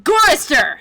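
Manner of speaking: loud, high falling call; summoning shout. Acted. Computer villain singles out a captive, commanding shout. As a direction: bellow the victim's name.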